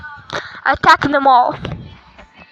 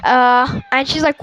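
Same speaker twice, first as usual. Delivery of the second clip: ordinary delivery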